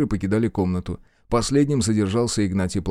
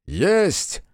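soldiers' shout; 8 semitones higher than usual. Acted. Soldiers answer in chorus.

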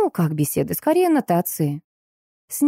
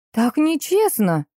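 slow, higher sulky whine; pouting; 8 semitones higher, slower than usual. A narrator's reading. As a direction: pout it out slowly. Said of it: "надулась девушка".

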